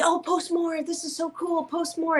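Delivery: high, excited voice of cheering fans; eager fans. Retold or imitated. Fans cheering her online posts.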